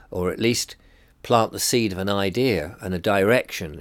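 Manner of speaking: normal talking voice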